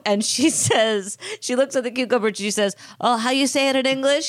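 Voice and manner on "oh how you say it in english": higher-pitched fake Spanish accent; mock Spanish accent. Mocking a TV guest faking it, put-on Spanish accent. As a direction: imitate a badly faked Spanish accent. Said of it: "making fun of her a-, acento".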